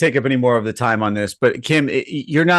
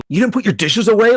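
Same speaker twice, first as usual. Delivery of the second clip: mock freak-out; 8 semitones higher than usual. Mocks own freak-out over dishes.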